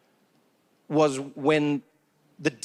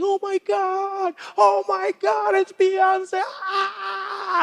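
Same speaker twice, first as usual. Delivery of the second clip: high-pitched mock fan squeal; drawn-out, swooning celebrity worship building to a scream; starstruck fan; 14 semitones higher, much slower than usual. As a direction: gush and shriek like an overwhelmed fan. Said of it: "people spotting a celebrity in the streets".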